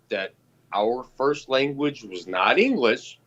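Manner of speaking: usual speaking voice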